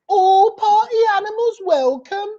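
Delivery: Birmingham accent, high, with a rising lilt. Shown as a demonstration. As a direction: show the Birmingham tune on the line. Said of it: "So in a Birmingham accent".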